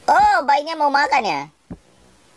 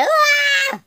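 sudden high wah shout; startled high yelp. Real, not acted. Gamer shocked by the game, sudden high yelp. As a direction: burst out in surprise. Startled outburst during gameplay.

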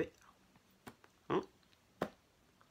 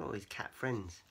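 usual speaking voice

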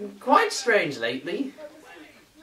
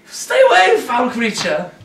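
alarmed cry; 6 dB louder than usual. Acted. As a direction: recoil and shout it. Recoiling from creature.

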